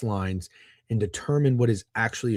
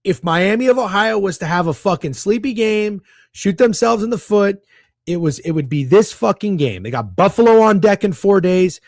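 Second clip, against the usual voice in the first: excited; 7 semitones higher, 7 dB louder than usual. Real, not acted. Hyped betting rant.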